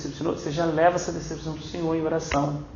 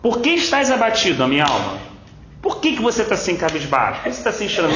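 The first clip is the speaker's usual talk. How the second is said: higher, louder self-rebuke; David scolding his soul; 7 semitones higher, 3 dB louder, faster than usual. Retold or imitated. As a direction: challenge your own soul like David did. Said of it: "ele brigou com ele mesmo".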